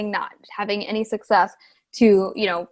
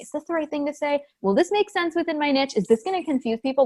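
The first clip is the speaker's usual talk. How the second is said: fretful overthinking; 6 semitones higher than usual. Retold or imitated. Imagined overanalyzing creators.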